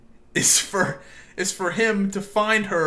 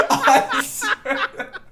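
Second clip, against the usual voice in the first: high, slow words dissolving into laughter; laughing; much slower than usual. Real, not acted. Friend trying to finish the story, high laughing. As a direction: laugh through it.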